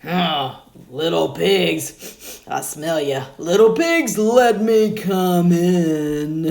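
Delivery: a loud, slow, growling wolf calling at the door; the big bad wolf demanding to be let in.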